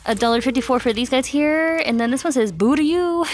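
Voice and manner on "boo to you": playful ghost voice. Pretend. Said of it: "And he's laughing about it".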